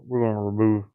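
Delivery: usual speaking voice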